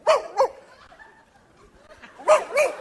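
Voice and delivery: high-pitched dog woofs; short, high barking woos; Lassie. Acted. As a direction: imitate an alert dog's yelps. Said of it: "Lassie who would go".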